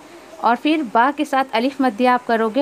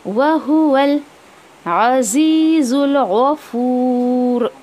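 slow, drawn-out tajweed chanting; Quran recitation; much slower than usual. Shown as a demonstration. Teacher reciting the verse slowly for students. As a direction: recite each word long and measured.

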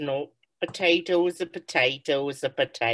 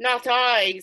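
put-on town drunk; 6 semitones higher than usual. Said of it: "said the drunkard".

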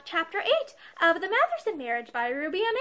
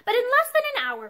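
ordinary delivery

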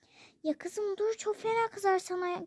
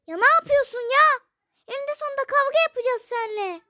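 high, loud and fast fury; furious shout; 8 semitones higher, 7 dB louder, faster than usual. Acted. Mother yelling at the father in their quarrel. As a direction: explode at him.

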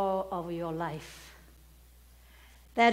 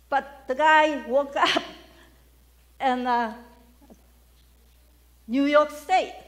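laughing loud; 4 semitones higher, 7 dB louder than usual. Real, not acted. Punchline build, laughing loud.

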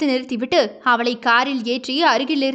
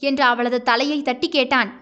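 ordinary delivery, nothing marked

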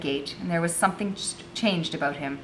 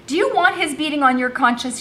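high, louder pointed question; scolding challenge; 9 semitones higher, 6 dB louder than usual. A narrator's reading. Becky pressing the girl about Curzon, scolding. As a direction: press them with a reproachful question.

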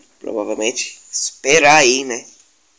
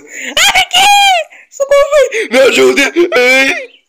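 high laughing shrieks of panic; being chased; 6 dB louder, slower than usual. Real, not acted. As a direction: scream for help while fleeing. Chased by animal, cries for help.

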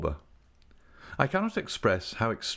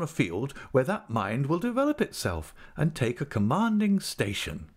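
higher, proud wife's voice; proud wife, lightly pitched up; lightly voiced lady; 8 semitones higher than usual. Mrs Micawber voice.

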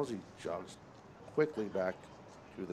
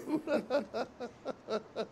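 high, soft running giggle; light high-pitched chuckling; amused laughter; 10 semitones higher, 8 dB quieter than usual. Real, not acted. Amused by the brush talk.

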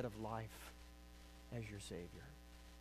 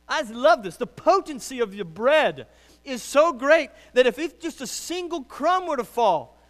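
high, loud, building pulpit fervor; exultant preaching; 11 semitones higher, 15 dB louder than usual. Real, not acted. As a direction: proclaim it passionately, climbing in pitch and force. Preaches at full force.

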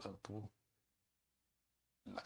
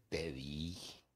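told you so; 5 semitones lower, 3 dB quieter than usual. Retold or imitated. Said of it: "Y así".

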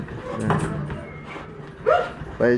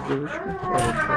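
squeaky praising a dog; 7 dB quieter than usual. Real, not acted. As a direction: coo up high at the dog.

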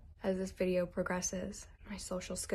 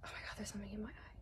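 fast voiceless whisper, much softer; startled whisper; 15 dB quieter, much faster than usual. Real, not acted. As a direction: hiss it quickly under your breath in alarm. Something in her eye.

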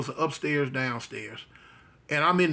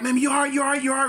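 higher-pitched, anxious checking; retold urgent worry; 8 semitones higher, much faster than usual. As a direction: ask urgently, reenacting a worried son. Panicked son checks on mother.

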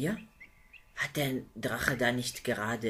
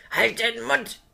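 much higher and louder, a monster's shout; furious roar; 11 semitones higher, 11 dB louder than usual. Acted. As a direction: bark her into silence. Dragon snaps at pleading princess.

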